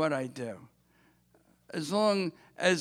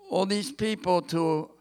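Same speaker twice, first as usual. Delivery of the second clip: ordinary talk, nothing marked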